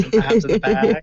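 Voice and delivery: bad Boston accent, dropped Rs. Shown as a demonstration. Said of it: "probably the worst Boston accent I've ever heard".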